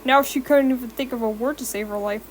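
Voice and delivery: ordinary delivery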